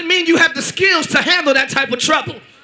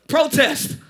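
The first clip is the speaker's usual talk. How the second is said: ordinary talk, nothing marked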